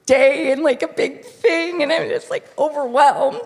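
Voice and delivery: high, gushing and teary-voiced; a young bride overwhelmed and apologizing; emotional young bride. Girl beside her in the shop, high emotional gushing. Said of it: "she went".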